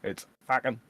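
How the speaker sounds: fucking pushed through the nose, Aussie Bogan. Shown as a demonstration. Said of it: "closed and pushing the sound out through your nose".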